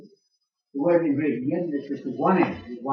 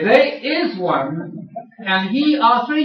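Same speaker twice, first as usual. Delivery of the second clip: drawn out, each word slow, rising; slow, deliberate mock creed; slow mock solemn; 6 semitones higher, 5 dB louder, much slower than usual. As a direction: spell out the absurd formula slowly. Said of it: "you must be prepared to say".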